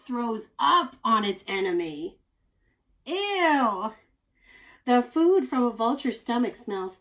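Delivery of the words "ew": loud high ew of disgust. Real, not acted. Teacher reacting to the vomit fact, loud disgusted ew. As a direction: squeal your disgust.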